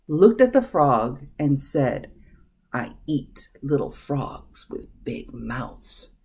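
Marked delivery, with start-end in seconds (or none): 2.7-6.1 quiet, slow, low snake voice; hissing snake. A narrator's reading. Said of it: "The snake hissed".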